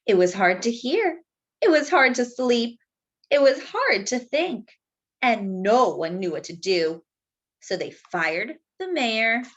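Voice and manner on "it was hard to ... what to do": plain reading voice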